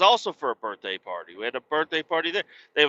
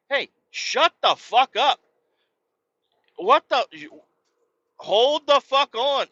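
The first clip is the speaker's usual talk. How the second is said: higher, slow, exasperated snap; retold angry retort; 13 semitones higher, 4 dB louder, slower than usual. Dad snapping back at lifeguards while buckling a life jacket, mock anger. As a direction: fire back at the lifeguards, fed up. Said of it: "And I'm like".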